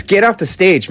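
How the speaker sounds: high, firm mock command; mock order. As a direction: bark it off the stage, hammed-up. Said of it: "he says".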